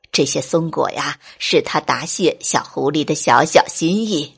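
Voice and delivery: low teacher voice, apologetic; sheepish goat teacher. Acted. Goat teacher apologising to fox mother, low and sheepish. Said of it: "山羊老师羞红了脸， 说".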